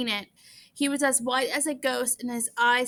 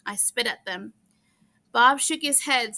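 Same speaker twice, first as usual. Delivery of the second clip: plain reading voice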